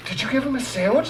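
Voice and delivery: put-on high-pitched girl questioning a coworker; girl off the phone. Store girl asking delivery kid, high off-phone voice. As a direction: turn from the phone in a high girl's voice. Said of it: "it's just kinda off the phone".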